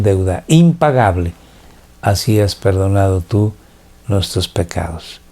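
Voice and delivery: hushed pastor's prayer, fading at the end; closing prayer. Acted.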